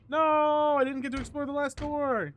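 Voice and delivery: mock dismay. Playful mock protest in game.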